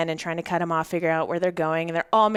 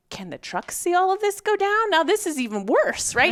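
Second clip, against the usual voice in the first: much higher, retold flustered worry; mock panic; 10 semitones higher, 4 dB louder than usual. Retelling her dread that the truck is watching, in high mock panic. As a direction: squeak out the fear of being watched. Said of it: "now I'm like".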